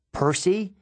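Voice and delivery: high worried call of his name; shouted name. A narrator's reading. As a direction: call out to find him. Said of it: "my mom shouted".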